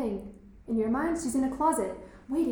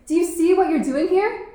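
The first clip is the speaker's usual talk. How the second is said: raised, urgent demand; heated; 5 semitones higher, 9 dB louder than usual. Acted. Boy holding her during the struggle, raised and heated. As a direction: make her look at what she does. Grappling over knife.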